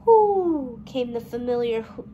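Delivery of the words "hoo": high, loud hooting owl. A narrator's reading. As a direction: call out like an owl in the dusk. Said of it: "hoot of the owl".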